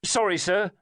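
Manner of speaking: a hurried apology called out; called hurriedly. A narrator's reading. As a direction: driver calling sorry over his shoulder. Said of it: "called hurriedly".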